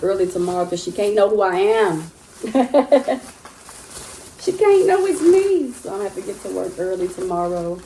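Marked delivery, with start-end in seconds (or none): 2.4-5.7 high giggling squeal over keeping the secret; giggly secret. Real, not acted. Vlogger squeals to viewers about staying secret, giggling. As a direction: squeal that she mustn't find out.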